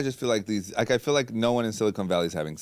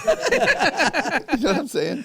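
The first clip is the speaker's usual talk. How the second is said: laughing; 4 dB louder, much slower than usual. Real, not acted. Laughing through the line.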